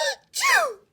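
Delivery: a sudden high shouted achoo; loud sneeze. Acted. Mister Sneeze in cold Fort Saint John, loud sneeze. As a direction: blast out the sneeze.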